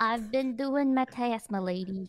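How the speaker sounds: lightly put-on old lady; botched British accent as an old lady. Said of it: "I'm just gonna sound like an old lady".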